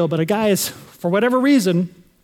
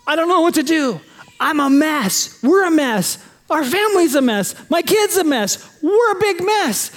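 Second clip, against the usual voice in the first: overwhelmed confession; 6 semitones higher than usual. Retold or imitated. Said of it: "It's like saying".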